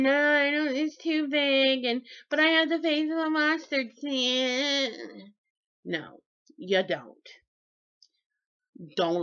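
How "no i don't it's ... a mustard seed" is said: higher, mock-whiny doubter voice. Mocking a doubter who refuses help, higher and whining. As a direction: parody the stubborn doubter. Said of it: "and you're going".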